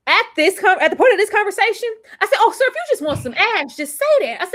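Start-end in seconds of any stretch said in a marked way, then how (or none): 0.1-1.9 excited. Real, not acted. Building excited rant. 2.4-4.4 sassy. Retold or imitated. Sassy retort to Marcus.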